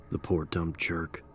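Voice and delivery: ordinary delivery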